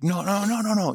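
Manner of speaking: high, loud string of no's; protesting. Retold or imitated. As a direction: deny it emphatically. Protesting in retold chat.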